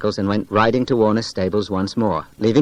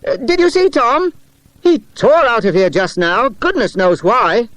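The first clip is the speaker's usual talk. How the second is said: calling out; 11 semitones higher, 4 dB louder than usual. A narrator's reading. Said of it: "Peter called to Jack and Charles".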